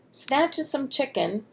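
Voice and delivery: ordinary delivery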